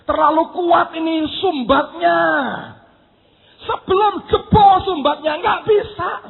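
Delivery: high, strained-up refusal; emphatic protest. Acted. Holy Spirit refuses while the plug holds, emphatic protest.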